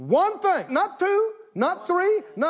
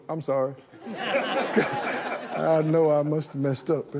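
mock contrite apology; 5 semitones lower, slower than usual. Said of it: "You can go".

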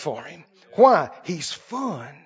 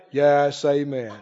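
mock solemn holler; 7 semitones lower, slower than usual. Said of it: "you holler from across the way".

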